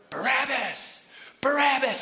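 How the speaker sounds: loud, high chanting shout; crowd yelling. Acted. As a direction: yell it like an angry mob. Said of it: "They start yelling".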